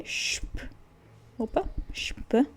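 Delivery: sp said shp, hushed sh then p; soft shp sound. Shown as a demonstration. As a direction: start the word with shp. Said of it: "o som de X P".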